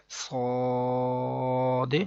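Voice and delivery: صاد with a long rounded vowel and echoed d; elongated, bounced ending. Shown as a demonstration. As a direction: hold صاد evenly, then bounce the final d. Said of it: "ایسے ہی یاد کریں گے". (صاد لمبا، آخر میں د ہلا کر)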